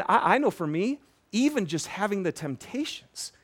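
normal talking voice

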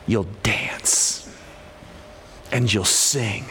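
soft, low, breathy promise; hushed and tender; whispered promise. Real, not acted. Hushed promise after verse.

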